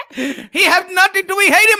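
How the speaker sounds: high put-on voice, laughing, on "He have nothing to be hated"; high, laughing put-on take of "He have nothing to be hated"; mock voice. Voiced take vs plain repeat.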